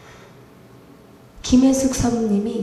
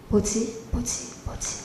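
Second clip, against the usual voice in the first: fading stunned murmur; 13 dB quieter, much slower than usual. Retold or imitated. Shock at sick mother's face.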